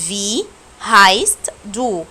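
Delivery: هايست drawn out slowly with a clear s; slow careful "vi haist du", s not b; a slow, clear model of هايست; slow German demo. Shown as a demonstration. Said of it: "انا نطقته زي الاس مش بي".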